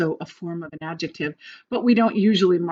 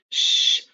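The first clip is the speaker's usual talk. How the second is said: long hushing "shh"; shushing. Shown as a demonstration. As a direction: shush someone in a library. Acts out shushing a student.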